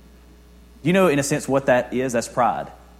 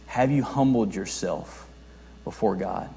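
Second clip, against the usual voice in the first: solemn question; 2 semitones lower, 6 dB quieter, slower than usual. Real, not acted. Preacher putting the question to each listener, solemn and hushed. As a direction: ask it gently, leaving room to reflect. Repeats searching question to congregation.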